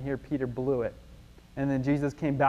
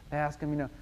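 ordinary talk

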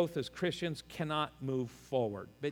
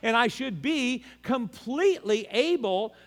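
raised, driving sermon voice, slowing at the end; preaching; 7 semitones higher, 5 dB louder than usual. Acted. Pastor drives home loyalty to the congregation, preaching. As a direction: press the point home from the pulpit.

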